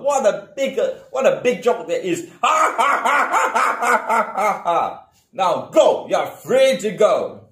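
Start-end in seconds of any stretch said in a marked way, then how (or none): 2.4-5.0 mocking laugh. Lion mocks the mouse.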